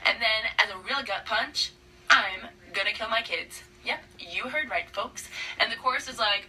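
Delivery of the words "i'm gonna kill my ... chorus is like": normal talking voice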